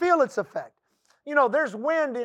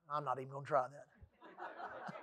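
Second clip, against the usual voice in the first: much softer, laughing it off; laughing aside; 10 semitones lower, 20 dB quieter than usual. Real, not acted. Preacher giving up on a tricky word, laughing softly. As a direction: chuckle and give up on the word. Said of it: "how do you say it".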